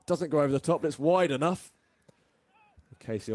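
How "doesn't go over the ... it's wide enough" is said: higher, louder live call; excited. Real, not acted. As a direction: lift the call as the ball flies. Live call of a near miss.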